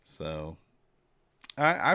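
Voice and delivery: ordinary delivery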